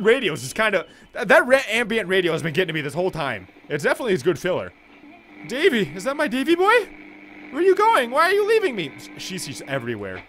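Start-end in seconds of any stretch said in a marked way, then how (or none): 5.5-8.9 high, loud pleading mother voice. Acted. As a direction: beg her son not to leave.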